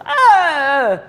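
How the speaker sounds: high loud startled "Ah!"; startled shriek. Acted. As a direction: shriek in sudden fright. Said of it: "and she just goes".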